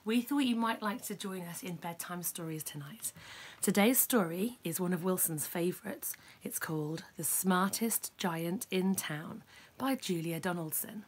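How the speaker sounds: ordinary delivery, nothing marked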